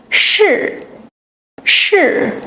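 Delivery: 是 said sharply with a clear falling fourth tone; falling tone drill. Shown as a demonstration. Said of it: "pronouncing it with the falling tone".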